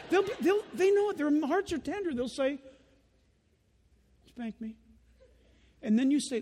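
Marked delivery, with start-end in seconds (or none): 4.3-4.8 small quiet voice asking for a spanking; soft child plea. Retold or imitated. Child begging dad softly for a spanking. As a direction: meekly ask for the spanking.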